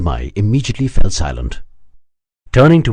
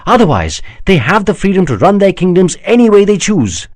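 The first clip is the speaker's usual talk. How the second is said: an outraged prince, voice raised and pitched up; higher, louder bitter defence against the charge; indignant prince; 9 semitones higher, 6 dB louder than usual. Acted. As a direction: protest the insult, growing heated. Prince explains the confederacy.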